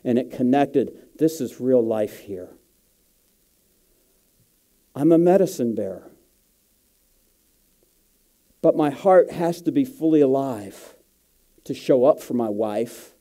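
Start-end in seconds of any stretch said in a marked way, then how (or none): none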